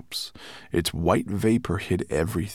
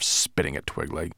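usual speaking voice

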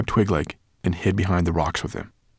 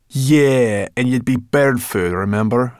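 Sorrel voice; 5 semitones higher, 8 dB louder than usual. Acted. Sorrel character voice retort.

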